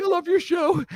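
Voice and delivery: very high mock-gushing voice; gushing fan quote. Host retelling a message to an actress, high gushing mimicry. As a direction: squeak it like a smitten fan.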